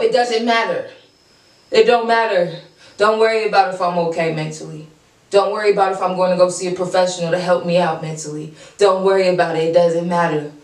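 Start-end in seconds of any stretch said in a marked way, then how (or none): none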